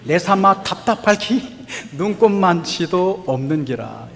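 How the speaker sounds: lightly voiced Gyeongsang dialect, laughing midway. Shown as a demonstration. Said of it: "경상도 버전이 제일 좋은 것 같아요". (웃음 섞인 가벼운 경상도 사투리)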